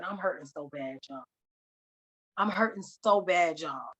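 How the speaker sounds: low, subdued retold grief; imitating tearful speech. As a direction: quote his hurt softly, without real sobs. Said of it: "he starts crying again".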